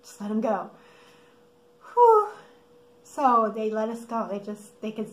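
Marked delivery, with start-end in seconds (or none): 1.9-2.3 loud, high exhaled whew; exaggerated phew of relief; re-enacted relieved whew. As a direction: blow out a big mock sigh of relief. Relief at being let go.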